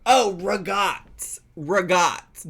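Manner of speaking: "regat" said slowly and loudly, "re-GAHT" with the last vowel cut; her ricotta pronunciation. Retold or imitated. Quoting Kira from Fifty Shades of Mom and her odd ricotta. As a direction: stretch "Oh, regat" and land hard on "regat". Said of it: "if I said the word regat".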